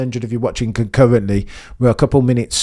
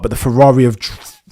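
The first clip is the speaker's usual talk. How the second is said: usual speaking voice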